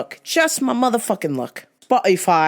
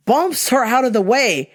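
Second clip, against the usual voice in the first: normal talking voice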